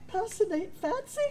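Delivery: high, laughing, questioning; panicked recovery. Retold or imitated. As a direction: squeak it out in panic. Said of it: "terror went around his face".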